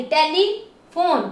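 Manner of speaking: Telephone slowly, as tele and फोन; drawn-out, segmented telephone; syllable by syllable. Shown as a demonstration. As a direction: sound out both parts of telephone. Teacher splits word into syllables.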